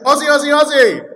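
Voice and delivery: very loud, high, quick sporting chant; full-force crowd call. Shown as a demonstration. Preacher retries the call to the church, fast rousing shout. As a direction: belt it fast to rouse the room. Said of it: "Can I try that again?".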